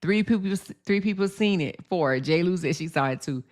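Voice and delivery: ordinary talk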